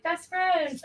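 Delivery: bright sugary "Best friends" read high; sweet reading. Retold or imitated. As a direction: read it like a cute greeting card. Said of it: "So it's like secret sass".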